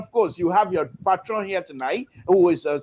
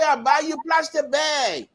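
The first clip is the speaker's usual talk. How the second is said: campaigning politician; 8 semitones higher than usual. Acted. Politician promising voters a gift, high and called out. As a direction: call it out like a politician on the stump. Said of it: "They will say".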